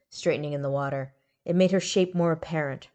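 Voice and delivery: plain reading voice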